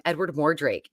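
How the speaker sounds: usual speaking voice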